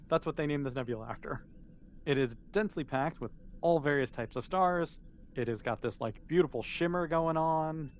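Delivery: usual speaking voice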